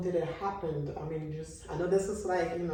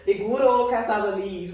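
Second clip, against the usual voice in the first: laughing; 5 semitones higher, 13 dB louder than usual. Real, not acted. Joking about shared food.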